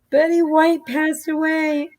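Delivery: high, plaintive re-enacted lament; wailed news. Mother explaining to Robert why she screamed, wailed lament.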